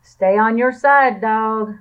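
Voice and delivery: loud call. A narrator's reading. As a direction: call it across the snow. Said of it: "called to Dog".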